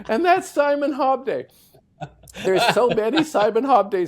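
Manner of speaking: high, loud, still laughing through the words. Real, not acted. Golfer wraps up the Hobday tale, still laughing loudly. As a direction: talk while the laugh dies down.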